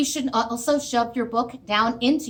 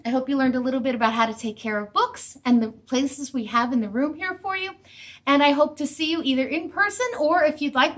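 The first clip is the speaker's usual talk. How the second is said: ordinary delivery, nothing marked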